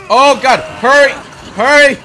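loud, high cries of alarm; urgent shouting. Real, not acted. Gamer to companion under threat, urgent shouting. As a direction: urge them on at high pitch and volume. Urging in a tense game moment.

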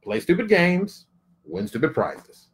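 slow, measured delivery, falling on the second half; deliberate saying. Real, not acted. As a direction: lay it out slowly like a maxim. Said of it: "I'm gonna say it like this".

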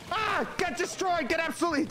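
high excited crowing over the win; a high victory whoop; triumphant gloating. Real, not acted. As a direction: rub it in their face. Wins a fight, gloats.

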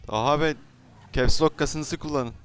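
usual speaking voice

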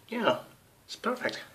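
high-pitched delighted squeal; thrilled and gleeful; excited. Real, not acted. Delighted with fresh split tongue.

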